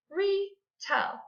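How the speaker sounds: "Retell" in two parts, first part high; counted syllables. Shown as a demonstration. Teacher counting syllables for pupils, split into beats. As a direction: break "Retell" into re and tell. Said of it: "How many syllables was that?".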